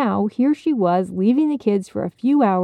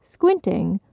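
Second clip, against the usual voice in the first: usual speaking voice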